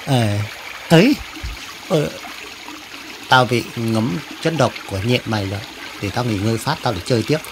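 low, soft, weakened voice; poisoned and fading. Acted. Poisoned animal asking to rest a while, weakly. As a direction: sag into a feeble murmur as venom sets in.

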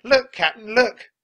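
very high, loud excited cry; excited high-pitched henchman; gleeful shriek. A narrator's reading. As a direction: shout down a thrilling discovery. Pirate's gleeful shriek from treehouse.

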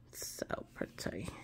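soft slow whisper; whispered so pretty to herself; whispered repeat. Real, not acted. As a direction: breathe it out in awe.

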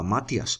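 Matías as MA-tyas, two syllables; wrong stress. Shown as a demonstration. Teacher warning against an error, Matías as MA-tyas. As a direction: stress the first a of Matías, gliding the i. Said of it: "para que no se diga Matías".

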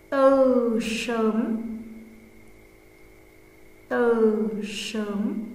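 slow, word by word dictation; dictating. Shown as a demonstration. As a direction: read slowly for pupils to write. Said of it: "cô giáo sẽ đọc, hai đến ba lần".

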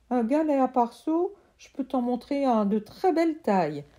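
put-on a kid pleading to be heard; boy's voice.

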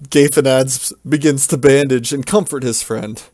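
usual speaking voice

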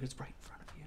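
whispered aside. Retold or imitated. Retelling the dragon scene, whispered aside. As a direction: murmur it under your breath. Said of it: "And he's like".

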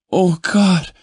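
high and loud cry of pleasure; cried out. Acted. Woman at her peak with her lover, crying out high and loud. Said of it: "she cried".

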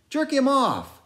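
high, loud, urgent; shouted order. A narrator's reading. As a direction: bark it at the sailor.

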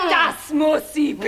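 low shouted threat; angry witch threat. Acted. Witch demanding punishment, low angry shout. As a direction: condemn her harshly.

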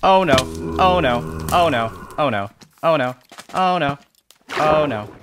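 rising panic, loud repeated oh no; panicked. Real, not acted. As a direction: panic as the scare hits.

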